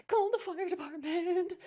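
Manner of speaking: loud, high frantic cry; cat lady crying for help; cried out. A narrator's reading. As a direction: panic for help. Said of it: "she cried".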